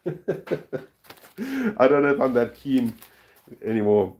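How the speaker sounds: giggling at a joke from chat; laughing. Real, not acted. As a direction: laugh it out while talking.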